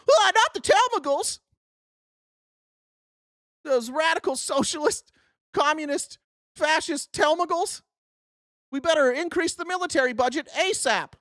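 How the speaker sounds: high, hammed-up alarm; mock panicked partisan. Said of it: "That's what you sound like".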